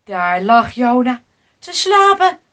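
ordinary delivery

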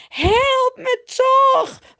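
high, drawn-out loud wail; pitiful screaming. A narrator's reading. Potifar's wife plays pitiful before the men, wailing loudly. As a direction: wail it, playing the poor victim. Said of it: "roept ze en ze doet nou opeens heel zielig".

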